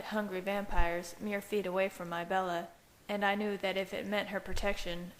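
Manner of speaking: plain reading voice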